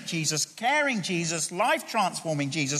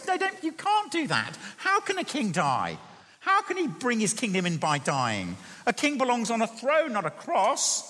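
higher-pitched, incredulous objection; acted protest; 4 semitones higher than usual. Preacher acts Peter objecting to Jesus going to die, incredulous protest. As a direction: argue back in disbelief, pitch climbing. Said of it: "And Peter goes".

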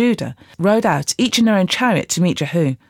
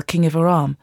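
plain reading voice

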